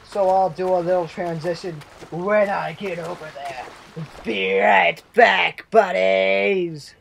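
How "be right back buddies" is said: slow, drawn-out sing-song goodbye. Pretend. Youtuber to viewers, slow sing-song goodbye. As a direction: stretch it like a cheery kids' host.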